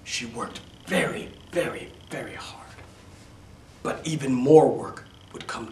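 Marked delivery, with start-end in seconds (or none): 0.0-2.8 hushed emphasis. A narrator's reading. Narrator stresses hard work.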